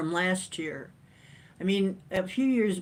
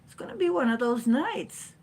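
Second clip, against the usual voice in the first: high-pitched goofy squeak; silly high voice; 9 semitones higher than usual. Pretend. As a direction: squeak it out like a cartoon. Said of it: "why we're talking like that".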